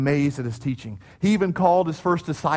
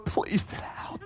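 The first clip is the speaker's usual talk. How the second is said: whispered, embarrassed aside; hushed plea; 11 dB quieter, slower than usual. Retold or imitated. Adult to a kid standing on his seat, hushed plea. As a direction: hiss it under your breath.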